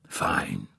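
a bare, breathless whisper of one word; whispered fine. Acted. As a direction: let the word fade into a whisper. Old man answers faintly.

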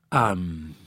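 low hesitant filler; hesitating. A narrator's reading. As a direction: stall before admitting it. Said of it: "Rapp hesitated".

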